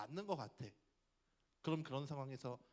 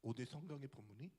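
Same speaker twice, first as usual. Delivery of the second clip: usual speaking voice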